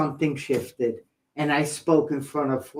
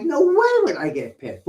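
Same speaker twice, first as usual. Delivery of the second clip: disbelief; 10 semitones higher than usual. Retold or imitated. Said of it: "And I'm like".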